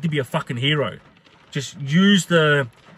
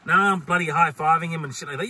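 ordinary talk, nothing marked

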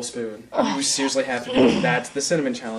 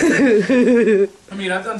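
loud laughing words; 8 semitones higher, 9 dB louder, much slower than usual. Real, not acted. Still laughing.

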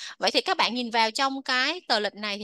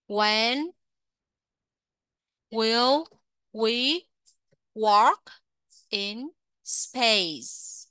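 slow, word by word with pauses; model sentence; much slower than usual. Shown as a demonstration. Teacher modelling the question slowly for pupils. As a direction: lay out each word separately. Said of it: "cô làm mẫu cho các bạn một câu nha".

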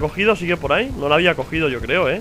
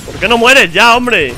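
1 angry yell; 5 semitones higher, 7 dB louder, slower than usual. Real, not acted. Yelling at enemy in game.

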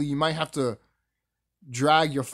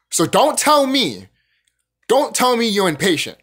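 impatient rant; 3 semitones higher, 7 dB louder than usual. Real, not acted. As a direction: drive home how impatient he is. Ranting about his own impatience.

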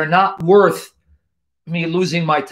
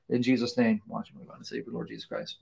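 much softer and lower, fading to a whisper; whispered closing prayer; 6 semitones lower, 13 dB quieter than usual. Acted. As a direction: breathe the last words of the prayer.

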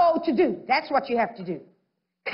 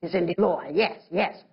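dismissive brush-off; 9 semitones lower, 5 dB quieter than usual. Retold or imitated. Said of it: "They're like".

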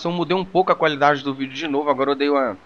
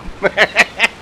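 far laugh; 4 semitones higher, 5 dB louder than usual. Real, not acted. Laughs after getting hit.

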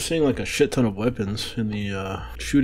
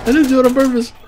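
high loud protest; defensive shout; 14 semitones higher, 8 dB louder, faster than usual. Real, not acted. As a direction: protest loudly. Defending himself.

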